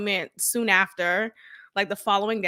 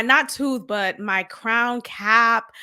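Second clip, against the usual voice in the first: normal talking voice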